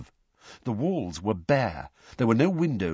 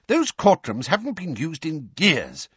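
higher-pitched, mildly angry protest; 7 semitones higher than usual. A narrator's reading. Weasley in the lift about old courtrooms, angry. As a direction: protest with a touch of anger. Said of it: "said Mister Weasley angrily".

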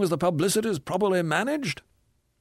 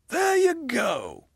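slow, higher, gloating "There you go"; triumphant; 9 semitones higher, much slower than usual. Acted. Hard-bitten ghost catching the narrator asking for a plan, triumphant. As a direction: savour catching him out, drawn out slowly. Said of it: "with a certain triumph".

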